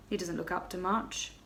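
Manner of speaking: ordinary delivery, nothing marked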